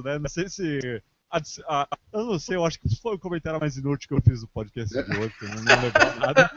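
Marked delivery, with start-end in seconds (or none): 2.6-6.5 laughing. Real, not acted. Laughing at own useless comment.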